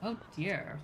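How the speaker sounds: ordinary delivery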